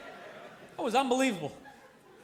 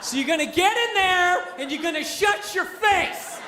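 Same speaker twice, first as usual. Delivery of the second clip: raised, drawn-out mock command; barked order; 4 semitones higher, slower than usual. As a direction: yell it like a bully boss laying down the law. Voices the cubicle giving orders.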